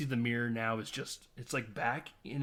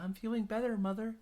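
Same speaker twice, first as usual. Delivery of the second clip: lightly voiced timid daughter, higher pitch; daughter placating mother; 10 semitones higher than usual. Daughter tells her mother she feels better, mild placating voice.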